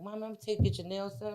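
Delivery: eager to Mom. Retold or imitated. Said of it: "I'm like".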